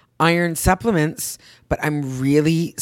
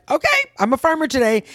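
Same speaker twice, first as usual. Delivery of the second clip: much higher, bright mock-cheerful; 9 semitones higher than usual. Imagining waking at four thirty, mock cheerful. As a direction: chirp it like a keen early riser.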